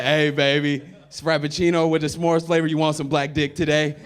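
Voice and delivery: loud, hammed-up pickup voice; mock smooth pickup line. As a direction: sell the cheesy line loudly. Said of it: "be like".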